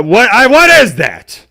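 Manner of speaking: high, slow baffled question; puzzled by a weird hashtag. Real, not acted. Confused by odd hashtag.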